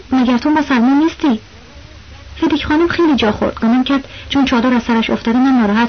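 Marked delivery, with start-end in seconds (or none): none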